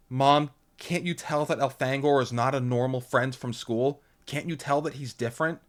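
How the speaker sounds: put-on a girl pressing her mom; a lightly voiced uneasy teen; Lauren. A narrator's reading. Lauren pleads.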